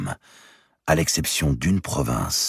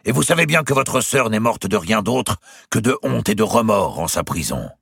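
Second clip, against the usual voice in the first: higher, louder, pressing the accusation home; Valois's accusing reply; 7 semitones higher, 4 dB louder than usual. A narrator's reading. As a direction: Valois throws the shame back at Eudes. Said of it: "répliquait Valois".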